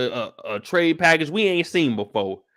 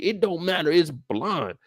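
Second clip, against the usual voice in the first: ordinary talk, nothing marked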